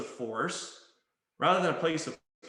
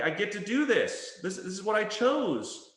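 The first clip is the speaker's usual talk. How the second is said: higher, affirming voice; empowered choice; 5 semitones higher than usual. Shown as a demonstration. Coach replacing forceful language, empowered and upbeat. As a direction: lift it up as something freely chosen. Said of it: "So let's replace it".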